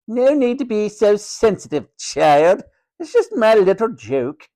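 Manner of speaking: high, haughty, dismissive matron; condescending matron. A narrator's reading. Matron dismissing George's protest, high and condescending. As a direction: brush off his hurt with condescension.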